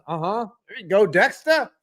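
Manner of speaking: ordinary talk, nothing marked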